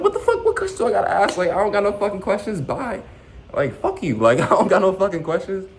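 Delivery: high, loud outraged rant; inner fury. Retold or imitated. Venting what he felt while fired, high outraged rant. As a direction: let the inner rage burst out high. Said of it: "That's how I was feeling".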